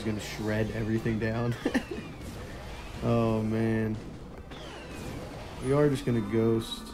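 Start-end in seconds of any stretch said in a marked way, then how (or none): none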